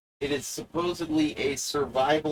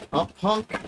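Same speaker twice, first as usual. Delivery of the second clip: higher, taunting challenge; mock tough guy; 6 semitones higher than usual. Presenter taunting viewer to test fear, mock tough challenge.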